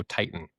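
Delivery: "Titan" with the middle T left out; invisible T. Shown as a demonstration. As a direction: swallow the second T of "Titan" before the n. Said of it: "it's not even there".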